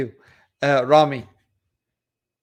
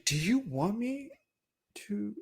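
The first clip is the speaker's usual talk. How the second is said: mock soft; 5 semitones higher, 12 dB quieter than usual. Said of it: "I'm just playing".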